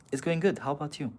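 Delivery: ordinary delivery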